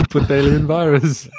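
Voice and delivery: mock Russian accent. Joining the Russian scientist bit.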